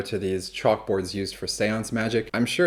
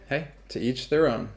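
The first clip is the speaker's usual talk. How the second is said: ordinary delivery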